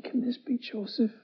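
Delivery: awed whisper of shocked brothers; disbelieving whisper. Acted. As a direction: breathe it out in stunned wonder.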